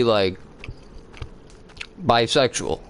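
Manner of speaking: ordinary talk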